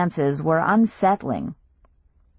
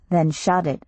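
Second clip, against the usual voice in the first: plain reading voice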